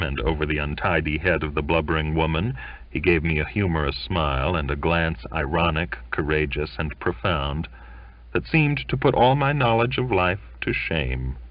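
plain reading voice

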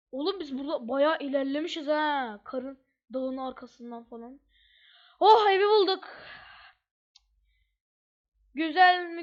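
usual speaking voice

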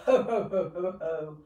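deep "ho, ho" laughter, fading out; ho laugh demo. Shown as a demonstration. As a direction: belly laugh "ho, ho" from low down. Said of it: "let's do a ho".

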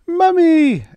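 put-on piercing little-girl scream; panicked child's shriek. A narrator's reading. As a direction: scream for mother, very high and loud. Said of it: "Emily shrieked".

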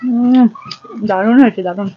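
slight mouth full; chewing between words; talking after a bite. Real, not acted. Seen 0: Bite taken at -4s; mouth still working food while speaking.

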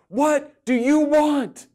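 loud, high, drawn-out imitation of a movie shout; hammed-up demand, stretched and pushed high; exasperated mock yell. Man quoting The Notebook meme, mock exasperated yell.

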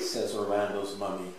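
plain reading voice

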